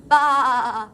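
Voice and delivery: loud sheep bleat. Acted. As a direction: bleat like a lamb. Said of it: "this animal says".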